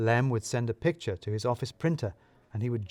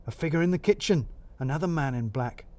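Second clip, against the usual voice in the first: ordinary delivery, nothing marked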